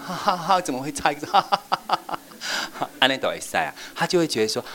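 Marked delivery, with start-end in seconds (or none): 0.1-2.2 high, cheerful laughing voice; light teacher laughter, no scolding. Shown as a demonstration. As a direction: laugh warmly through the slip. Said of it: "你只要这样".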